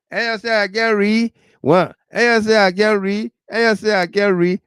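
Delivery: high, mocking voice; mock someone bragging they can read; hammed-up show-off; mock boaster.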